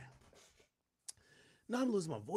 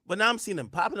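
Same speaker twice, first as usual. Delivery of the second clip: worked up; 8 semitones higher, 6 dB louder, much faster than usual. Real, not acted. Venting about rappers dissing him.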